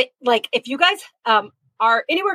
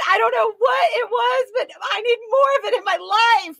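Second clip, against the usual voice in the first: much higher, half-shouted gush of delight; real fan excitement, higher and shouting; excited gushing; 10 semitones higher than usual. Real, not acted. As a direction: squeal how much you love it. Gushing over the Brit skit.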